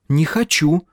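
high-pitched stubborn refusal; girlish foot-stamping protest; petulant refusal. A narrator's reading. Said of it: "топнула ножкой Птраси".

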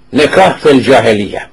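ordinary talk

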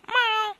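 high, loud meow; cat meow. A narrator's reading. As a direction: round off with a mew. Cat's meow misheard as a word. (miagolio acuto)

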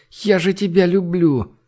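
high-pitched, moaning declaration; moaned plea. A narrator's reading. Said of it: "простонала Женя ему вслед". (высокий стон вслед)

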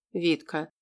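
ordinary delivery

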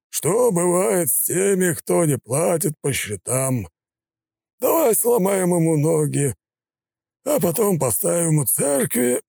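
high, slow, contemptuous gangster; sneering thug voice. A narrator's reading. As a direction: gloat over his victim.

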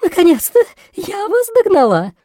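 out of breath. A narrator's reading. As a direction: gasp it out after running. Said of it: "задыхаясь от быстрого бега".

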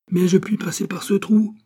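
high-pitched urgent protest; crying out. A narrator's reading. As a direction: call it out from inside the cell. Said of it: "criait le docteur".

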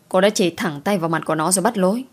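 ordinary delivery, nothing marked

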